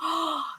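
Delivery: soft, amazed intake of breath; delighted gasp. Real, not acted. Streamer hearing of poetry from tarot, amazed gasp. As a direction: gasp in pleased surprise. Reacting to viewer's poetry message.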